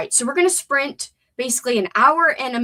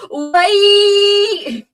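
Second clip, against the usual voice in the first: high shouted drawn-out why; Keenan; 11 semitones higher, 10 dB louder than usual. Retold or imitated. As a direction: belt it out like the sketch comedian. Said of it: "As Keenan would say".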